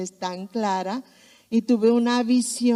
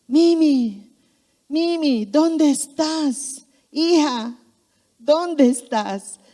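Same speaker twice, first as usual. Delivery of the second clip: higher, louder calls, drawn out slowly; calling out; 5 semitones higher, 4 dB louder, much slower than usual. Retold or imitated. Father searching for his daughter at dusk, calling loudly. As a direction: call for the lost child across the yard.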